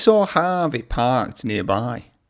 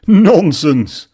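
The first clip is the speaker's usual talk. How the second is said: brisk dismissal; 5 semitones higher, 7 dB louder than usual. A narrator's reading. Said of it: "said the Fat Controller".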